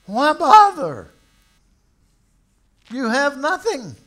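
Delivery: loud, high, fiery preacher's call; preaching at full force. Acted. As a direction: thunder it from the pulpit. Preaching opening of sermon.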